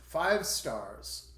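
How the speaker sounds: ordinary talk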